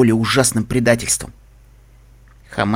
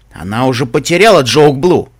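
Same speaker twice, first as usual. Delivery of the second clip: rough, louder retort; gruff; 7 dB louder than usual. A narrator's reading. Narrator voicing Лайфблум rebuking his friend, gruff. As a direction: add it roughly, voice pushed up. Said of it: "Потом грубо добавил".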